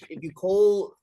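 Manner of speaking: joking shout at the viewers; mock warning. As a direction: warn the audience with a ham threat. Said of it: "Anyone who watches this from now on".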